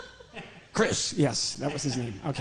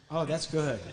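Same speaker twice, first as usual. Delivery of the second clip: ordinary talk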